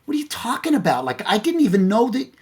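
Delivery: very high, incredulous wife voice; protesting wife. Retold or imitated. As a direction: imitate a wife protesting in disbelief. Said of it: "She goes".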